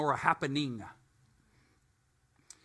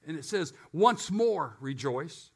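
ordinary talk, nothing marked

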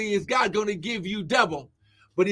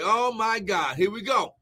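ordinary talk, nothing marked

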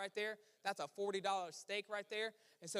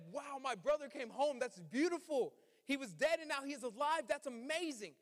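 higher, bright imagined rejoicing; joyful welcome; 5 semitones higher than usual. Retold or imitated. Older brother as he should have greeted him, joyfully raised. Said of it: "Instead of".

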